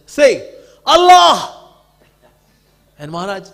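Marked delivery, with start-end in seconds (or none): 0.1-1.6 loud, high angry shout; fanatic's demand. Retold or imitated. Fanatic yelling at Maharaj, angry shout. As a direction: bark the order at him. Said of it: "he was fanatic about Allah".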